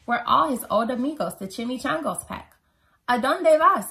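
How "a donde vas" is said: high, loud storybook call; raised-voice character shout. A narrator's reading. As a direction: call the question out to the gang. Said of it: "called out Skipito".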